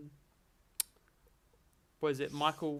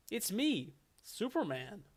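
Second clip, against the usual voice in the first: lightly voiced, higher American hero; generic hero, pitched up a little; Superman; 5 semitones higher, slower than usual. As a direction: announce yourself as a stock comic-book hero.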